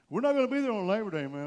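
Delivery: big, hurried playful objection; mock protest. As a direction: blurt it out as a churchgoer begging off. Voices congregation skipping Labor Day.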